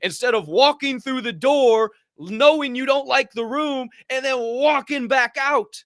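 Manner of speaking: building rant. Real, not acted. Rising rant.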